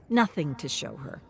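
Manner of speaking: ordinary delivery, nothing marked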